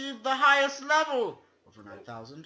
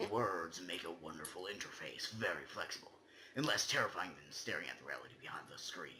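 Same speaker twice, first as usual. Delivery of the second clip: rushed, hushed whisper; fast whisper; 14 dB quieter, faster than usual. Acted. Reading the poem's eerie line to friends, rushed whisper. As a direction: hurry it out under your breath.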